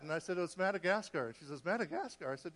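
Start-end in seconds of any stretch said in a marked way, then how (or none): 1.6-2.3 higher, questioning disbelief; acting out a stunned wife; incredulous echo. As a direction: echo the name as a startled question. Wife echoes the name in disbelief.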